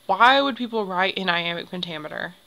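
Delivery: usual speaking voice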